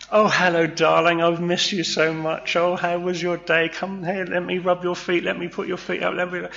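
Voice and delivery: high, doting wife voice; breathy vintage purr. Acted. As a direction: coo sweetly like a devoted wife.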